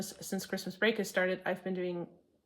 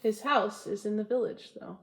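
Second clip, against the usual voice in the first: poem reading. Acted. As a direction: savour each line of the poem. Reads Frost poem to viewers.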